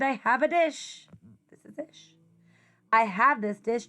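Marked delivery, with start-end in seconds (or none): 1.5-2.1 whispered introduction; hushed aside to the listener. Acted. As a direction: breathe the name softly.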